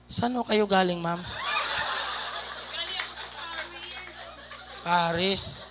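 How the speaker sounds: soft, low mock courtesy; quiet little role-play; acted polite exchange. Acted exchange with pretty passenger.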